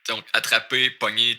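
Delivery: usual speaking voice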